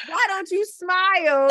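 put-on high, loud, slow child's demand; little kid. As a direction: imitate a kid bluntly questioning a grown-up. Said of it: "another one where the little kid was like".

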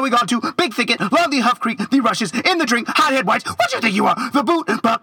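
ordinary talk

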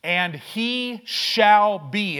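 "And he shall be" drawn out slow and loud, every word weighted; slow emphatic. Shown as a demonstration. Preacher stressing God's promise to the congregation, slow loud emphasis. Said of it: "This is the strongest language".

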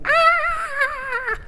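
long, very high shriek; hammed-up scream of a frightened parent; mock panicked scream. Adults panicking as dog approaches.

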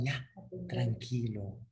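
soft, slow, spaced-out lulling; hushed soothing. Acted. Heavenly voices telling the man to rest, soft slow hush. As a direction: ease him under, word by word, very quietly.

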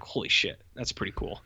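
hushed, breathless holy shit; awed whisper. Retold or imitated. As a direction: whisper it like you can't believe the title. Imagining a viewer's awed reaction.

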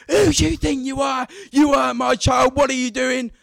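high, screeching-mad woman yelling; vile furious shout. Retold or imitated. Angry mother confronts the child who hurt hers, furious high shouting. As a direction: let loud abuse spill out. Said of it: "just pure vileness spills out".

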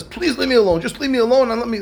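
high, hurried begging; pleading dismissal. Retold or imitated. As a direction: beg to be left alone. Blind man begs to be left.

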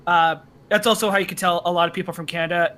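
normal talking voice

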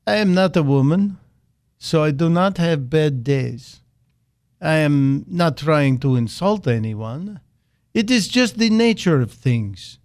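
mock Russian accent, deliberately bad; a hammed-up Russian accent impersonating Putin. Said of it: "my best Vladimir Putin bad accent".

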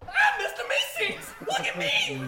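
squeaky yelling eager helper creature; Mister Meeseeks. Acted. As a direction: burst out like an overexcited genie. DM voices summoned Mister Meeseeks.

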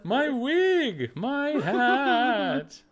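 high, loud, flustered cry; scandalized society lady. Pretend. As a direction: wail about the ruined hat. Mock outraged audience.

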